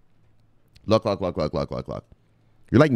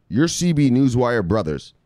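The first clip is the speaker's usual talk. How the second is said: normal talking voice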